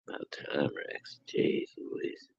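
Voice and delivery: breathy stage whisper to the co-host; hushed whisper of relief. Real, not acted. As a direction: whisper it like a teasing aside. Mock whisper as co-host arrives.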